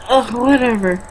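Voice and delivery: ordinary talk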